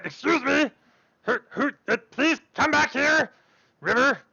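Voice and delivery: high, stammering call after someone; high-pitched, pleading alarm in a put-on voice; flustered girl calling. Girl calls back a visitor heading upstairs, high flustered plea.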